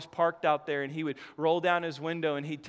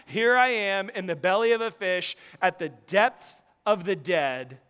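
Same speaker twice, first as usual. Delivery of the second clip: loud preacher's cry to God; impassioned preaching; 2 semitones higher, 6 dB louder than usual. Acted. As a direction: call out from the depths, voice raised.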